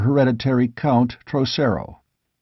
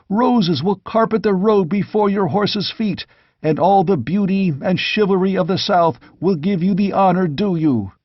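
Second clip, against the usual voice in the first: higher, glowing with enthusiasm; eager pleading; 7 semitones higher than usual. A narrator's reading. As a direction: paint the triumph for your king. Knights urge a triumphant welcome.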